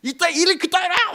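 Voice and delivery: a mock furious rebuke; retold yell from an angry pastor; scolding pastor. Pastors scolding him over the bus.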